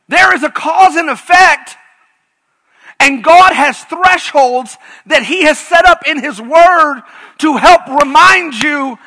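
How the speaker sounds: loud, high, shouted pulpit fervor; full-force preaching. Acted. Pastor shouting warnings about God's thresholds at full force. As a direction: preach it like a revival, near crying out.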